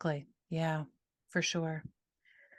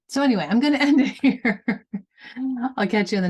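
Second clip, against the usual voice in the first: laughing; 5 semitones higher, 7 dB louder, faster than usual. Real, not acted. Laughing while signing off.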